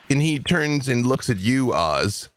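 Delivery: ordinary talk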